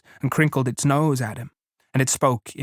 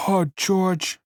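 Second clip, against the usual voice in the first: high, rising calls for George; Lenny crying out for George; 6 semitones higher, much slower than usual. A narrator's reading. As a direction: call out desperately. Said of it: "And he cried".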